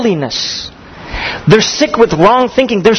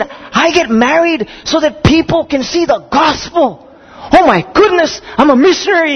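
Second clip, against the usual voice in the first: much higher, thrilled, voice lifting to oh my goodness; overjoyed groom; 8 semitones higher than usual. Retold or imitated. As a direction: act out his joyful revelation. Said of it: "And he was just happy".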